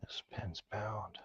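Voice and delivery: hushed whisper to himself. Real, not acted. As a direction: breathe the words out, no voice. Focused lock picking muttered aside.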